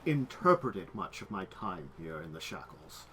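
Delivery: low, soft voice with weighty pauses; measured, lawyerly reasoning; formal, deliberate game character. Acted. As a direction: lay out the argument piece by piece.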